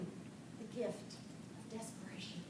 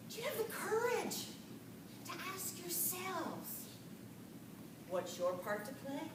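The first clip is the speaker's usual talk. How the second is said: soft reflective question; 8 dB quieter than usual. Real, not acted. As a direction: ask it gently to make them think. Gently asking the audience.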